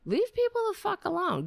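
high, fast furious rant; angry outburst. Real, not acted. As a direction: yell it in exasperation. Ranting at religious people.

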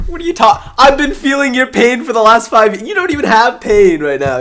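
high, loud incredulous retort; heated, indignant banter; exasperated protest. Real, not acted. Heated retort to a complaining rival fan.

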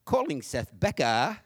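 slow mock British GPS voice; British woman. British GPS assistant answering his call command, mock British accent. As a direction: put on a drawn-out British accent, dropping the R. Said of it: "to a British woman".

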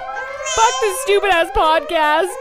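usual speaking voice

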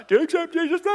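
cartoonish high-pitched baptizer; mock baptizer. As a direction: parody yourself asking it, high and silly. Caricature of the baptizer.

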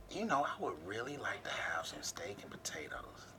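very soft, sultry-style whisper; hushed hinting.

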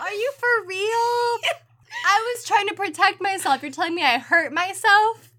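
high, loud indignant protest; outraged disbelief. Real, not acted. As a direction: squeal it in shocked disbelief. Mock outrage at the list.

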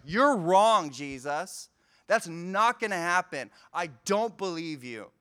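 loud, sharp contradiction of Jesus; defiant retort. Retold or imitated. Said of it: "Peter's like".

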